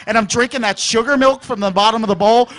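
ordinary talk

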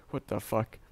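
ordinary talk

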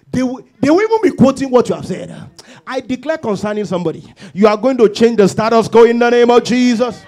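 full-force preaching. Real, not acted. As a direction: build to a ringing proclamation.

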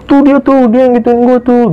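very high-pitched retold panic; imitated frightened accusation. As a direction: point out the culprit in a high, upset mock voice. Retelling crying girl pointing into tent. (menirukan tuduhan panik dengan nada tinggi)

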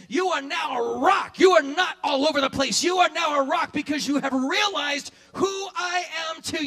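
loud, ringing declaration as Jesus; Jesus declaring Peter a rock, shouted; preaching shout. Acted. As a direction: proclaim it over him with full force.

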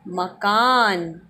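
मकान said slowly after the syllables; slow blended word. Shown as a demonstration.

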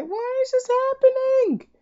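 very high, squeaky wail of despair; squeaky whine. Real, not acted. Gamer losing cells wails at the game in a high squeal. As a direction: let the question climb into a cartoonish squeal.

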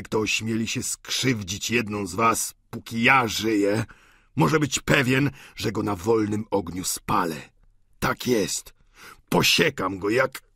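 put-on low, rising oath of an enraged old father; swearing to burn whoever hurts his girls; old Goriot, vengeful. Goriot's furious vow.

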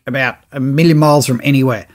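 ordinary delivery

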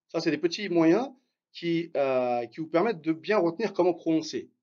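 usual speaking voice

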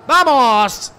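loud high cry of joy; real celebration shout; celebratory shout. Real, not acted. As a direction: yell it out at the goal. Celebrating an assist in game.

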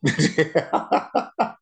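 breathy high burst of laughter at recognizing the singer; excited laugh. Real, not acted. Reactor laughing as he recognizes [name]'s voice, delighted laugh.

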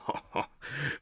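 breathy little laugh; soft chuckle. Acted. Thin man laughing softly at school memories.

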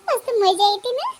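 put-on high, giggly mischievous kid; acted high-pitched glee; gleeful child. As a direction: relish the coming fight like a delighted child. Child gleeful about coming fight.

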